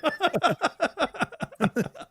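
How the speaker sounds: high burst of laughter. Real, not acted. Friend laughing at the watch story.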